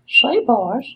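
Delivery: very high-pitched put-on voice; cartoon character voice. Shown as a demonstration. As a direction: pipe up high like a cartoon sidekick. Sudden demo of a silly voice.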